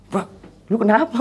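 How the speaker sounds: high-pitched startled mock question. Woken friend asks the narrator, startled. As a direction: act out a friend waking confused.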